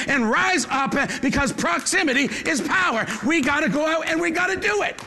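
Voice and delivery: fervent preaching. Acted. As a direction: urge the church to rise up. Preaching at full force.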